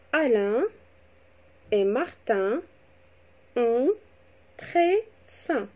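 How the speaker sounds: measured classroom dictation with gaps between words. Shown as a demonstration. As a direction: dictate to pupils, pausing after each word. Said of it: "mot par mot".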